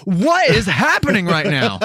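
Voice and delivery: high, slow, baffled what is happening; high drawn-out bewildered question; mock disbelief. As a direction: play it stunned and incredulous. Retold baffled reaction.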